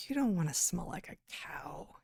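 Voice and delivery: hushed, conspiratorial whisper; mock whisper. Host mimicking the disgust pitch, whispered. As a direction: whisper it like a scare tactic.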